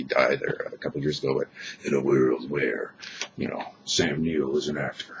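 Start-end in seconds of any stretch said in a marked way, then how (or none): 1.8-5.1 movie trailer voice. Retold or imitated. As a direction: intone it like an epic movie preview. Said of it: "the guy that does the, uh, previews".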